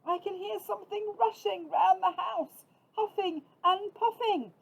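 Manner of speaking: high, loud squeaky-small mouse voice; a tiny mouse telling big news; little mouse voice. A narrator's reading.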